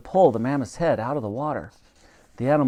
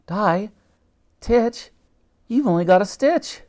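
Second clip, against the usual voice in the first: incredulous; 8 semitones higher, 4 dB louder than usual. A narrator's reading. Elsie disbelieves Titch.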